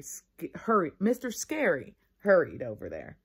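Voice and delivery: ordinary delivery, nothing marked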